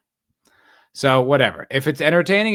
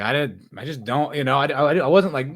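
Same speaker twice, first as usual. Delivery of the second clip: usual speaking voice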